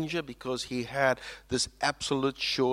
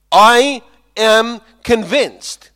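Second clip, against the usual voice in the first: slow, loud, high declaration; proclaimed conviction; 10 semitones higher, 18 dB louder, much slower than usual. Acted. Preacher booming Paul's conviction to the congregation. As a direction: declare it slowly with full conviction.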